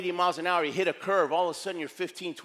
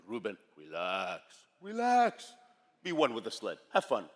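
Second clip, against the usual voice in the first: put-on deep Schwarzenegger-like Austrian accent; Austrian coach; 7 semitones lower than usual. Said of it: "sounds like Arnold Schwarzenegger".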